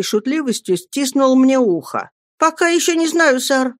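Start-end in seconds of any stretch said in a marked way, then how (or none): 2.4-3.7 put-on high-pitched boy's reply; frightened mumble. A narrator's reading. Pupil trying to free his ear, high mumbled answer. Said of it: "пробормотал я".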